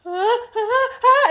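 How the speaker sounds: high startled alarm cries; mock frightened cry. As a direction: imitate a scared friend spotting a spider. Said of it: "she's like".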